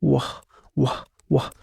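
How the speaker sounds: W said as a slow "وه" sound; teacher sounding out "وه" slowly; phonics sound for W. Shown as a demonstration. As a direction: draw out "وه" as the bare letter sound. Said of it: "نطق وه".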